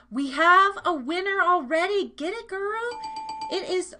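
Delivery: loud, high whoop of excitement; excited winner call. Real, not acted. Host announcing a quiz winner to the chat, loud excited whoop.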